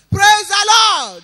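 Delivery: high, slow, loud shout of praise. Real, not acted. Testifier calls the church to praise, slow loud shout.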